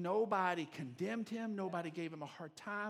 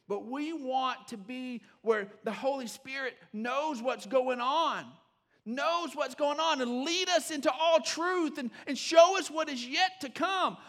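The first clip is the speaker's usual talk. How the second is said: slow, high, loud preaching; slow emphatic preaching; 8 semitones higher, 10 dB louder, slower than usual. Acted. Pastor stressing the Holy Spirit's guidance, slow and preaching. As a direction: stretch each phrase and lift it.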